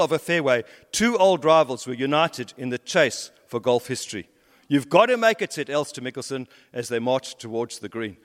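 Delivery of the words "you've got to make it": higher, louder cheer of encouragement; urging shout. Retold or imitated. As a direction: rival egging him on, higher and louder. Golfer urging his rival on.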